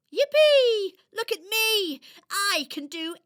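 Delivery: high, loud whoops of joy; joyful cheering. Acted. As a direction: cheer like a kid on top of the world. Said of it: "Elva jumped for joy".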